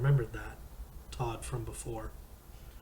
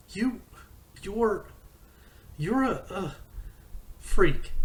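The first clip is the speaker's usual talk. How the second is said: higher, stuttering, building to a loud shout on freak; stammering angry outburst; 8 semitones higher, 6 dB louder, slower than usual. Acted. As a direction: choke on the words, then spit out freak. Todd explodes at the narrator.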